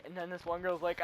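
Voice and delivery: ordinary delivery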